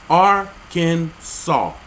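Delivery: Arkansas broken slowly into Ar-kan-saw; three separate syllables. Shown as a demonstration. Reading the state rule aloud, Arkansas as slow Ar-kan-saw. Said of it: "pronunciation is in three syllables".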